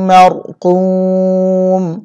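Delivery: slow melodic tilawat, each vowel drawn out; Quran recitation. Shown as a demonstration. Teacher reciting the verse to students in slow tilawat. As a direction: chant it like a reciter, slow and sung. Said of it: "teacher recites the verse end".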